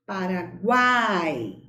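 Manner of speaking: "Paraguai" slow, the "A" of "guai" stressed, the "U" and "I" weaker; slow stressed demo. Shown as a demonstration. Teacher to pupils, after explaining strong and weak vowels, slowly. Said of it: "a letra U é pronunciada com menos intensidade".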